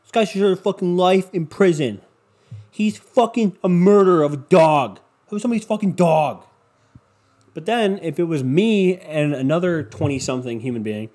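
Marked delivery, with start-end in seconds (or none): 0.0-6.5 louder, hammed-up indignant online crowd; louder mock outrage about a killed dog; mock outraged commenter. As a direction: play the outraged internet mob. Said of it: "everyone online is like".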